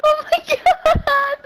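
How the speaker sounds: high, slow, squealed through laughter; real helpless laughing. Real, not acted. Friend losing it over the story, laughing. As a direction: squeal it while cracking up.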